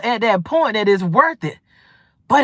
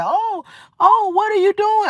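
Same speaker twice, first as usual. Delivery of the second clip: high startled mock voice, much higher; mocking them; 11 semitones higher than usual. Mocking the people who tested her, in a high startled voice. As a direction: squeak it out like a panicked fool. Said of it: "then they like".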